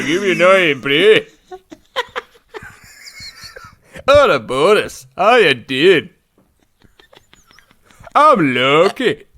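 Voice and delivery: mock Scottish accent, laughing at yourself; bad Scottish accent. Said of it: "I can't do Scottish".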